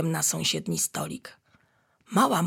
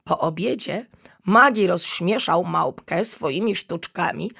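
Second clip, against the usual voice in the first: ordinary delivery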